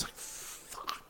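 long, voiceless whispered curse; whispered dismay. Retold or imitated. Writer hearing his co-writer must step in, whispered dismay. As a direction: breathe it out slowly under your breath. Retelling his defeated reaction to bad news.

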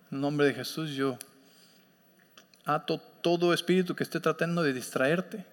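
soft, low deliverance prayer; quiet prayer. Acted. Pastor praying over distracted listeners, softly binding spirits. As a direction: bind the spirits in a hushed prayer.

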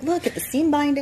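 eager, rising delivery; excited showing off. Retold or imitated. Job seeker showing off her suit to her friend, excited. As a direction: gush over each detail of the outfit. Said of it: "so excited about the embroidery".

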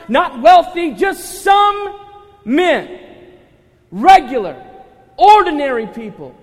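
loud, high, building sermon delivery; full-force preaching. Real, not acted. Pastor listing who the men were not, full-force preaching. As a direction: hammer out each item to the whole room.

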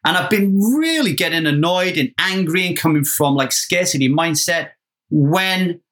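normal talking voice

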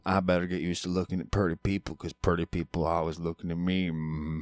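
soft, muffled backwoods character, grunt at the end; Carl Childers. Acted. Host recites the famous line, soft grunting impression. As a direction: mumble the famous line softly, capped with mm-hmm. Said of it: "That's the line".

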